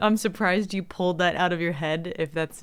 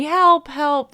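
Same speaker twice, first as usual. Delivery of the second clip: raised mock cry for help, higher and louder; imitated screaming; 7 semitones higher, 6 dB louder than usual. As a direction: imitate someone yelling for help. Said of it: "when Nick was screaming".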